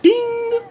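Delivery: a very high, bright ding sound; a sudden idea going ding; lightbulb ding. Retold or imitated. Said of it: "something clicked in my head like".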